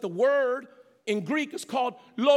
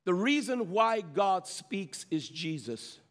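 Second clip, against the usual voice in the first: lowered pitch, drawn out word by word; slow, weighty statement; 4 semitones lower, slower than usual. Shown as a demonstration. Preacher puts it plainly for the church, slowly. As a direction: say it slowly so it sinks in. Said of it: "I'm gonna say it the way you probably need to hear it".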